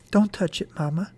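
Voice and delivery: plain reading voice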